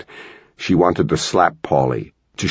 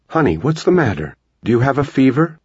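higher mom voice, anxious and caring; a mother fretting over her sick-looking boy; concerned mom asking if he is ill; worried mother; 8 semitones higher than usual. Acted. Mother worried about her son.

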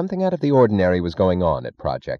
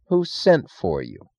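slow, higher, hesitant question; a shade uncertain; 8 semitones higher, slower than usual. A narrator's reading. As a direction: lose a little nerve. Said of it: "a shade uncertainly".

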